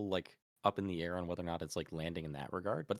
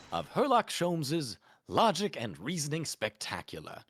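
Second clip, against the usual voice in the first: high, loud grand detective voice; theatrical British detective; 10 semitones higher, 5 dB louder than usual. Acted. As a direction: announce it like a proud sleuth. Character voice, grand announcement.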